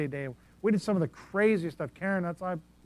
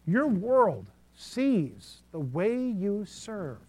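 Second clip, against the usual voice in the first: slow emphasis; 4 semitones higher, much slower than usual. Real, not acted. Slow emphatic sermon line.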